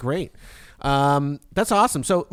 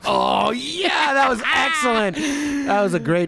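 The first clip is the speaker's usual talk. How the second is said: excited cheer; 5 semitones higher, slower than usual. Real, not acted. Cheering the sexy take.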